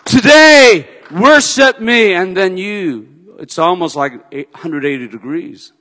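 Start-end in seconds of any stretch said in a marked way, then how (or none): 0.1-2.1 loud, commanding divine call; voice of the Lord. Acted. God speaking to the rescued believer, commanding. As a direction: proclaim it as God's command. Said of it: "and say".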